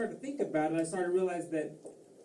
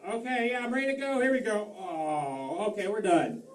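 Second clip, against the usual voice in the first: raised pitch, a bit louder, mock readiness; acted hotel guest; 5 semitones higher, 5 dB louder than usual. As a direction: call out that you're ready to go. Acting out leaving a hotel room.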